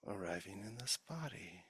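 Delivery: soft, unhurried meditation voice with long pauses; guided meditation. Real, not acted. Teacher leading the sangha's formal practice, soft guided meditation. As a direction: ease listeners gently into stillness.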